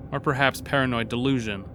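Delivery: usual speaking voice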